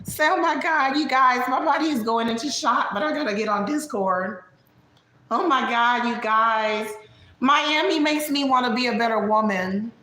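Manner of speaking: high, gushing put-on voice; high-pitched caricature of a dramatic friend; Vegas. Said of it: "imitate Vegas".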